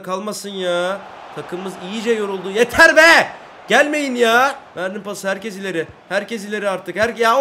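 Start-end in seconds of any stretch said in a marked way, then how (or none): 2.5-4.5 high, loud exasperated yell; fed-up shout. Real, not acted. Streamer fed up with the opponents' attacks, yelling. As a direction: throw it at the attackers.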